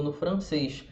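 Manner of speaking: ordinary delivery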